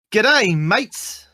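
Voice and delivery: hammed-up Australian accent, high and loud; over-the-top fake Australian accent; mock Australian. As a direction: ham up a fake Australian greeting. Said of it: "He doesn't actually say that".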